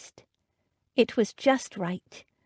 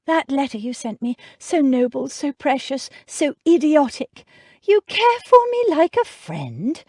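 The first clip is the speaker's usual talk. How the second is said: exasperated; 7 semitones higher, 6 dB louder than usual. A narrator's reading. Exasperated woman scolding fondly.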